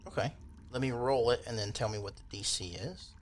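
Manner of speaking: normal talking voice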